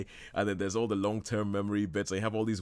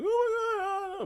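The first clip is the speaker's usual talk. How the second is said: much higher mock wail of frightened children; imitating scared kids; 4 dB louder than usual. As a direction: squeal like a kid scared in the cinema.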